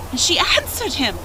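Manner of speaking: plain reading voice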